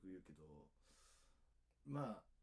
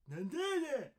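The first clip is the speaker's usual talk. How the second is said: loud, high mock Kansai comeback; teasing Kansai mimicry; 11 dB louder than usual. Tokyo man imitates people teasing in Kansai dialect, mocking shout. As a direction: exaggerate it to make fun of Kansai dialect. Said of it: "からかって".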